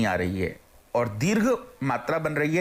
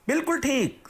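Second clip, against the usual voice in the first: cheerful praise; 9 semitones higher than usual. Pretend. Teacher praises imagined answer.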